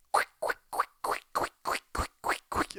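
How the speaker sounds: unvoiced whispered patter of quick steps. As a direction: whisper it like tiptoeing barefoot feet. Worker hurrying barefoot to kitchen.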